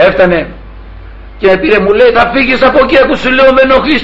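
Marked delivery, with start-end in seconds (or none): 2.1-4.0 higher-pitched threatening caller; mock menace, pitched up; imitated threat. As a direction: mimic an irate man giving orders. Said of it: "και με απειλούσε".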